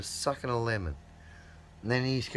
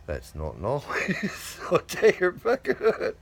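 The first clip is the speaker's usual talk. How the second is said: ordinary talk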